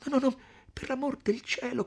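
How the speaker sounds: high-pitched frightened plea; distraught young woman pleading; tearful pleading. A narrator's reading. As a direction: beg in a high voice close to tears. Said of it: "il pianto le troncò la voce".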